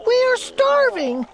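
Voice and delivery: high, pleading voice of a crushed turtle; groaning Mac. A narrator's reading. Mac pleads from the bottom of the stack, high and groaning. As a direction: beg the king from under the stack. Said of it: "groaned Mac".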